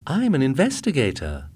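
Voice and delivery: high, eager wife voice; bragging about her husband; proud young wife. A narrator's reading. Sally voice.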